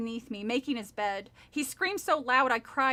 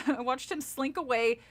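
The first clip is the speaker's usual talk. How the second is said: ordinary delivery, nothing marked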